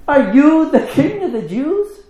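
very high, slow and loud mocking question; a Roman governor sneering down at the prisoner; incredulous governor. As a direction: Pilate demanding an answer from the prisoner. Governor interrogates Jesus.

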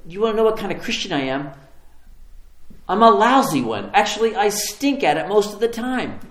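high, loud retold comeback; heated, emphatic self-indictment; defiant confession. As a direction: throw the admission back forcefully.

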